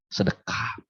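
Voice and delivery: breathy whispered Sedekah, almost no voice. Shown as a demonstration. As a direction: drop Sedekah to a secret whisper. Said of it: "Apa itu?".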